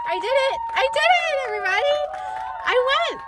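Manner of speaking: high, loud, joyful cheering; excited. Real, not acted. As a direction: shout it out like you just won. Celebrating after finishing an appointment.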